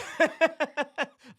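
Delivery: high, loud chuckle; hearty laugh. Real, not acted. Host amused at [name]'s failed chart run, hearty laugh. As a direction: burst out laughing.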